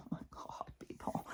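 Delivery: breathy, voiceless whisper; exasperated whisper. Real, not acted. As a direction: whisper it in disbelief. Exasperated aside.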